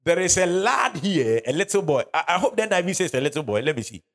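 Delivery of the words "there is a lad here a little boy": scripture reading. Acted. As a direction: raise the voice and drag the verse out. Rereads verse.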